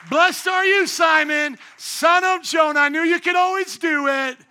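high, loud, triumphant proclamation; exultant praise. Acted. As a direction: cheer him on at full voice as a proud teacher. Preacher voices Jesus praising Peter.

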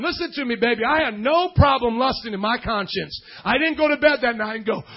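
ordinary delivery

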